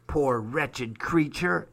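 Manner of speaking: slow, drawn-out pity; lightly voiced pitying lady; pitying exclamation. A narrator's reading. Woman in fur coat on seeing the hobo, pitying exclamation.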